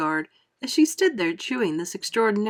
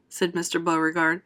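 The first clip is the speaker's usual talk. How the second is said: ordinary delivery, nothing marked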